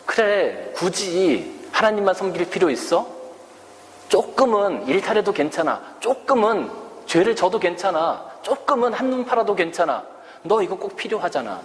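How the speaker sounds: lightly voiced coaxing devil; tempting Satan. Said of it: "사탄은 우리를 늘 유혹해요".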